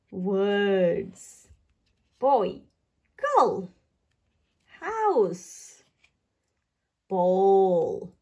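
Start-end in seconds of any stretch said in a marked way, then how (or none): none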